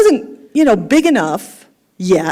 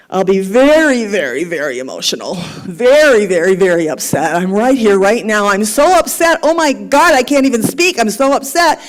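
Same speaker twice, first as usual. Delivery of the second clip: loud hammed-up emotional outburst; acted upset; 6 dB louder than usual. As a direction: play someone too upset to speak, loud and worked up. Acting out being very upset.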